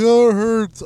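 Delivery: put-on high, loud whiny child; teacher mocks student whining, high and loud; whiny kid imitation. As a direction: whine it like a jealous kid. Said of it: "She's like".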